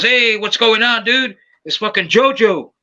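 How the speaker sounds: lightly acted, high-pitched hearty hello; upbeat phone greeting. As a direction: imitate a cousin cheerfully calling up. Voices cousin greeting him on phone.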